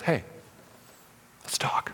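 hushed serpent invitation; whisper. Pretend. Serpent luring Eve to talk alone, whispering. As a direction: lure in a whisper. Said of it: "whisper in your ear".

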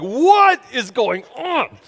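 slow, drawn-out exasperated yell; high, loud retold outburst; losing it. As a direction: stretch each word in mock fury. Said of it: "and I lose it".

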